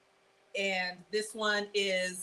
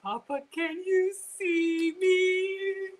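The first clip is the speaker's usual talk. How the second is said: slow, high "Papa, can you hear me"; hammed-up call to heaven; mock triumphant child; 7 semitones higher, much slower than usual.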